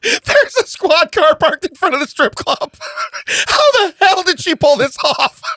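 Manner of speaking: high, slow, crying with laughter; laughing while talking. Real, not acted. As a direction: talk through helpless laughter.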